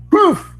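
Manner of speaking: loud high "poof" sound effect; Markiplier poof. Pretend. Narrator mimics Markiplier's poof for the flash of light. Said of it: "the Markiplier".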